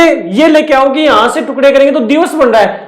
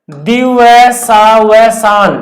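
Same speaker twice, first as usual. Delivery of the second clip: दिवसावसान stretched out very slowly, syllable by syllable; slow read-out; much slower than usual. Shown as a demonstration. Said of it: "जैसे आपके सामने शब्द लिखा हुआ है". (दिवसावसान को बहुत धीरे, एक-एक अक्षर पर रुककर पढ़ें)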